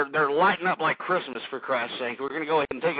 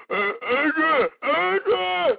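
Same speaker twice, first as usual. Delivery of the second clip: sudden high-pitched heys; hammed-up high yelps; mock calling out; 9 semitones higher, 3 dB louder, much slower than usual. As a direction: leap way up out of the bass voice. Tough-guy impression jumps to calling out.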